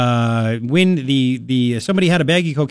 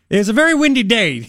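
higher, innocent-sounding alibi; mock excuse; 6 semitones higher than usual. Host mimicking the suspect talking to police, higher. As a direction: play innocent with an absurd excuse. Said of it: "Kenesha Posey said to the police".

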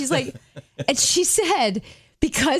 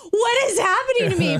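much higher, mock-despairing cry; high retold wail of a stressed woman; bewildered wail; 10 semitones higher than usual. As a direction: imitate her shock at her own meltdown. Woman overwhelmed by meltdown.

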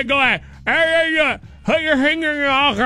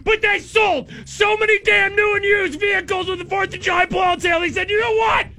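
ad read; 9 semitones higher than usual. Acted. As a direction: shout the sale like a dealer. Live ad read.

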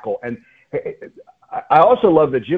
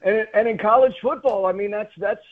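laughter trailing into high talk; still laughing; 8 semitones higher than usual. Real, not acted. Host still laughing as he returns to college football. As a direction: laugh it off and keep going.

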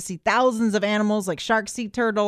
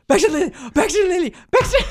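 high excited calling of dog names; excited doggy voice; 6 semitones higher, faster than usual. Retold or imitated. Greeting her two dogs at the door, high and excited. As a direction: call the dogs like you just got home. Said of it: "They'll be".

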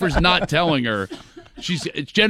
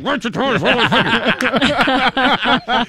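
slow, higher, laughing through closed lips; ventriloquist voice; 10 semitones higher, 4 dB louder, slower than usual. Retold or imitated. Linda asking Rollie through a ventriloquist smile, slow. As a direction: drag it out like a dummy. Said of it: "She said".

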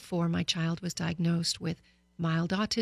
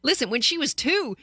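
ordinary talk

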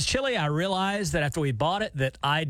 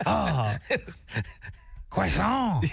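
put-on Jean-Luc French accent; much slower than usual. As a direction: play a dreamy French waiter with a French accent. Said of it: "Jean-Luc's over there in France hearing that commercial like".

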